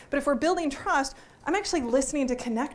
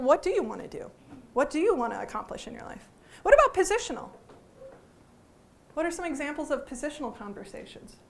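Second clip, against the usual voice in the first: ordinary talk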